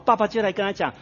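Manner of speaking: ordinary talk, nothing marked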